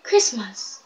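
Christmas said softly, imitating others; mocked pronunciation. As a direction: quietly mimic their odd Christmas. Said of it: "They were like".

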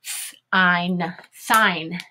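Th-, ein, then blended thine; teacher blending thine from onset and ending; sounding out. Shown as a demonstration. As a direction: sound out thine in parts with voiceless Th. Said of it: "Here we go".

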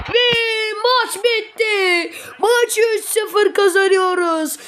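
high, slow final whistle cry; excited commentator call. Acted. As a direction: cry out the win, overjoyed.

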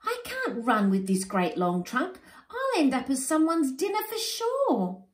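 high, loud fretting; panicked wail. Acted. Warthogs panicking over their new bodies, high wailing. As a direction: lament each new body part.

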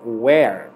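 where said with an open e, wɛr; open vowel where. Shown as a demonstration. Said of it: "ela tem o som aberto". (where com som aberto)

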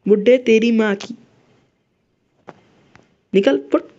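angry yell. Real, not acted. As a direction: shout him out of the way. Cursing at grandpa in game. (गुस्से में चिल्लाना)

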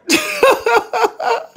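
high giggling laugh. Real, not acted. Laughs at own story.

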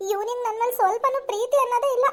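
usual speaking voice

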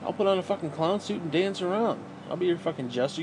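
ordinary talk

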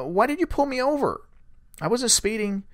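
high-pitched puzzled challenge; retold protest. Driver to deputy after being pulled over, high retold protest. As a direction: question it, pitched well up.

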